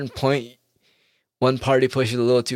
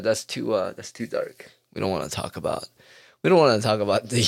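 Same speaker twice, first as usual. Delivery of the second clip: ordinary talk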